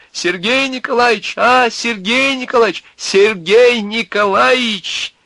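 very high, loud mocking call; shrieked summons, taking up someone's cry. A narrator's reading. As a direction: holler the name, stretching the last one. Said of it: "подхватил и Князев её зов".

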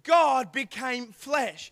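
very slow, weighty phrasing; each word given slow weight; slow, deliberate repeat. Shown as a demonstration. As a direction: spell it out slowly for them. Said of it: "You, you're not getting it".

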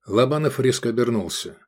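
usual speaking voice

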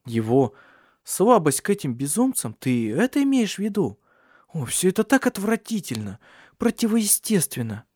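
high, swooping, appalled tone; disgusted. A narrator's reading. Ursula revolted by Alexander's weakness, disgusted. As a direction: recoil from the subject as you speak.

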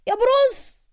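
very high call of بروس falling at the end. A narrator's reading. Suka's last high call after Bruce, falling away. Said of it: "وسوكا ينادي عليه".

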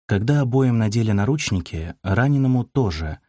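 plain reading voice

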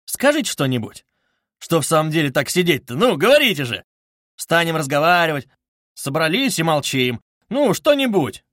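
loud, high, insistent urging; exclaiming. A narrator's reading. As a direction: badger the silent company to talk. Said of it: "вскрикнул он вдруг совсем неожиданно".

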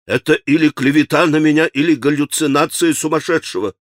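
ordinary delivery, nothing marked

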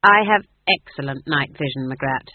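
put-on loud, stern old witch; firm, stern rebuttal. A narrator's reading. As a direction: put her in her place sternly. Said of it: "said Nanny sternly".

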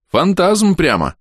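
громкий резкий рявк, медленно; рявкни, растягивая слова; рявкнул. A narrator's reading. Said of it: "рявкнул я". (громко рявкнуть с досадой)

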